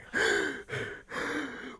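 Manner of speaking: hammed-up out-of-breath gasps; breathless panting after a race; acted panting. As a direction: huff and puff like a spent runner. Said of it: "he's just out of breath, huffing and puffing".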